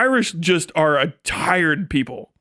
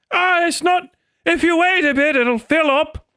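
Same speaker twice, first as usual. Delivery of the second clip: put-on old Irishman accent; 10 semitones higher than usual. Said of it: "the old man".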